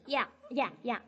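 ordinary delivery

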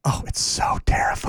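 hushed spooky whisper. Real, not acted. Whispering to co-host about a scary movie. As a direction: breathe it like a scary secret.

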